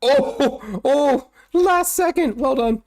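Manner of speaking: high, slow stretched-out cry; a real gasp at a last-second escape; excited yell. Real, not acted. Near-death clutch in a game.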